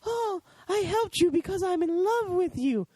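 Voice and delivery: very high, put-on swooning voice; mock a sappy love confession in falsetto; mock lovestruck woman. Reporter confessing love to the prisoner, mockingly lovestruck. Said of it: "Why does it have to always be".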